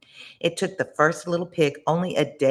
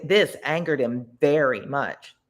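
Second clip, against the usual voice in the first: plain reading voice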